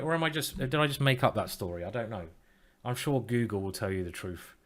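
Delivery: normal talking voice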